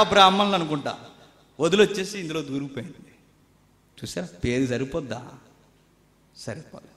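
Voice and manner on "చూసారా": ordinary talk, nothing marked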